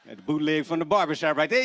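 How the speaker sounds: normal talking voice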